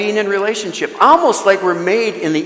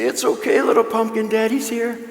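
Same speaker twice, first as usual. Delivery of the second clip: put-on soft, a touch higher, tender comfort to a child; gentle reassurance; 4 semitones higher, 4 dB quieter than usual. Father telling his hurt daughter Daddy's here, tender and soft. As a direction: reassure a hurt little girl, softly. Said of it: "We'd say".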